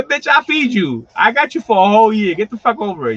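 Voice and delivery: high, loud mock yell at the dragon; exasperated yell. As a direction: owner yelling back at his biting pet. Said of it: "I mean".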